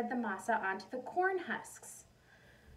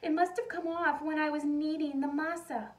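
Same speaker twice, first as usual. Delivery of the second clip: higher and louder panic; alarmed; 6 semitones higher, 6 dB louder than usual. Acted. Maria realizing she lost the ring, higher, louder panic. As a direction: blurt the fear out.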